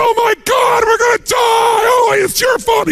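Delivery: shrieking high in terror; mock panicked shriek from a stranded safari truck; panicked scream. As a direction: scream like you're about to be eaten. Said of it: "He's screaming at the other guy".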